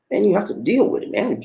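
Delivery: usual speaking voice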